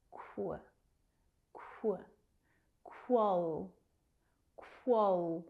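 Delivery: "Qu, qual" built up as kwuh, kwol; blended Q U. Shown as a demonstration. Teacher building a tricky word, "Qu, qual" in slow pieces. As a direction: blend k and w in "Qu", then extend to "qual". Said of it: "can be a little tricky to pronounce".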